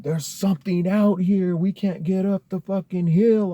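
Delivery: high, loud retold plea for help; acting out a frantic shout to neighbors; panicked calling out. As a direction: call out in alarm, pitched well up. Frightened group calling to the neighbors.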